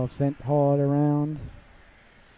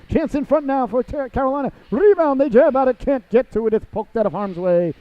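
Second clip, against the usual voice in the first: rising higher and louder through the rebound scramble; excited play call; 8 semitones higher, 5 dB louder than usual. Real, not acted. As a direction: call the chance in front with mounting urgency. Scramble in front of the net.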